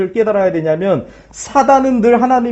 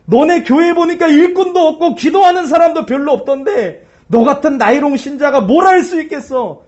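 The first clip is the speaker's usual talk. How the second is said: higher sneering voice of Satan; mocking Satan; 6 semitones higher than usual. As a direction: taunt the believer as the devil, pitched up. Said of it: "사단은 조롱하는 거예요". (사탄이 신자를 조롱하는 높은 목소리)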